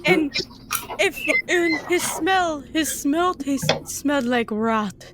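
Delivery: high, hungry monster voice; creepy creature voice. Acted. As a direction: purr it like a greedy beast.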